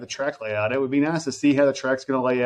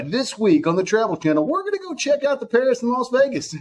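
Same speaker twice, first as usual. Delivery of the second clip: high TV travel host announcer; 11 semitones higher than usual. Pretend. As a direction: hype it like a channel announcer. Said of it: "That was my announcer voice".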